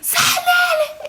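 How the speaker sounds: high, drawn-out panicked scream of her name. Retold or imitated. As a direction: shriek the name in panic, held long. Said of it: "Now they're screaming".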